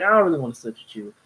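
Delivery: lightly voiced sulky husband, quick; a hint of a whiny grown man; mock childish refusal, fast. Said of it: "He's being a child".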